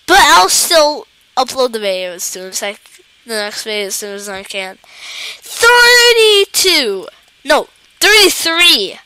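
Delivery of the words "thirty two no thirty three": drawn-out loud, high wail; loud lament. Real, not acted. Gamer short of thirty-five, wailing the score. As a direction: cry out the score slowly in dismay.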